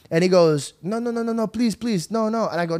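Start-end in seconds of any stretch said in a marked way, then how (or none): 0.8-2.5 mild pleading. Retold or imitated. Voices driver begging not to stop.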